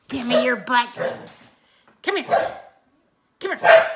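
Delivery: rile the dog up playfully; loud playful calling. Real, not acted.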